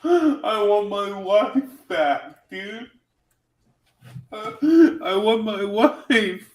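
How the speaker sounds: hammed-up whimper, high and slow with a laugh; mock whining. Mocking Nick's whiny complaints.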